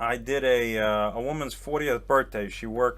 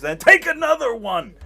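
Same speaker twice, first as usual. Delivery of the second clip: high, loud insistent exclamation; hammed-up, loud and pushy generosity; mock generous owner; 9 semitones higher, 9 dB louder than usual. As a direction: imitate a big spender pressing cash on someone. Acting out owner pushing more money.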